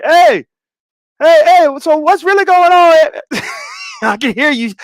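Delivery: mock screaming friend. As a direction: yell it like over machines. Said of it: "Of course you gotta be screaming".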